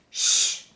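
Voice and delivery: loud unvoiced shh; loud shush. A narrator's reading. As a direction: hush the bear sharply. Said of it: "said the bluebirds".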